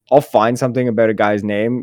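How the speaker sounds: normal talking voice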